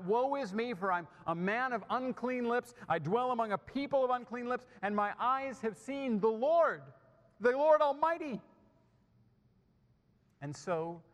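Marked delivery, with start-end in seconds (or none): 0.0-8.4 high, rising cry building to a loud peak. Acted. Isaiah cries out on seeing the Lord, voice raised. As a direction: cry it out, raised and mounting to the final words. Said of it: "and so he cries out".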